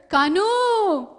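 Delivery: high, loud drawn-out calls; long falling shouts of the name; distant calling. Acted. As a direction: call out far across the fields. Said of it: "What does he hear?".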